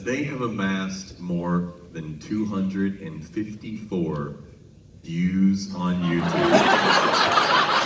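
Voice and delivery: hammed-up announcer, cracking up at the punchline; mock announcer. Opry announcer boasting of YouTube views, slow mock announcer breaking into laughter. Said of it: "And then he says".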